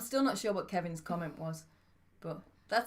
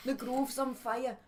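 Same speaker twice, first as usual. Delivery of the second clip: Geordie attempt; 4 semitones higher, much slower than usual. Shown as a demonstration. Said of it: "Oh, like that".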